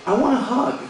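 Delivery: lightly voiced little girl; little daughter. Daughter coming up to daddy, lightly voiced little girl. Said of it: "she comes up to me and she goes".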